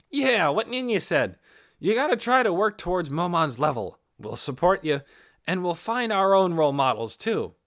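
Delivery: rally your friend, full-throated; loud, upbeat party-mate backing him up; hearty encouragement. A narrator's reading. Narrator voices Lukrut cheering.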